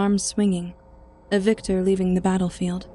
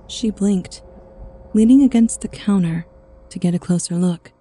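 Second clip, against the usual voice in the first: ordinary delivery, nothing marked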